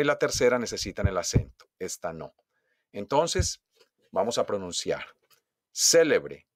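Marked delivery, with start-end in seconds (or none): 5.7-6.5 "Célebre" punched on CÉ; stress on first syllable. Shown as a demonstration. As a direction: hit CÉ, then let the rest of "Célebre" fall. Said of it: "Donde yo hago el acento". ("Célebre" con golpe de voz en CÉ)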